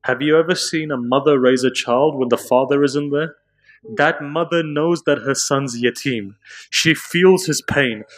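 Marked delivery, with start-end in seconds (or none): 4.0-8.1 mournful lament. Acted. Preacher builds the lament.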